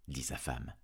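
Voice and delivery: usual speaking voice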